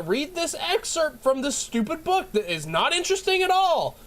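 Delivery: high-pitched, exasperated comic tirade; yelling rant. Real, not acted. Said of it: "I'm yelling at everything".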